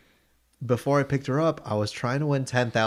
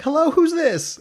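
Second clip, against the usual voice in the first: much higher, louder radio host greeting; bright mock DJ pickup, much higher; 7 dB louder than usual. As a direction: put on the perky on-air answer. Imitating the radio DJ answering.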